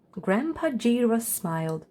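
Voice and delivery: usual speaking voice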